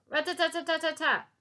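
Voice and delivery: high loud rattle; machine gun rattle. A narrator's reading.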